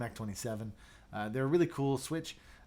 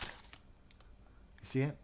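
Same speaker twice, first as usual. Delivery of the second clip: whisper; 12 dB quieter, much slower than usual. Real, not acted. Showing the keycap to camera.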